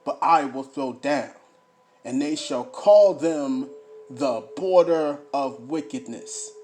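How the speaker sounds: loud, emphatic scripture reading; booming pulpit delivery; fiery preaching. Acted. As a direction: preach it to the congregation. Reads scripture, preaching loudly.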